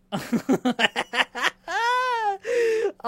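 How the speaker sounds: loud, high burst of real laughter; cracking up at own joke. Real, not acted. Own duck imitation.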